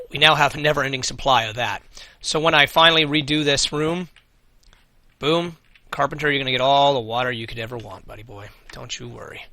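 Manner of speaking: normal talking voice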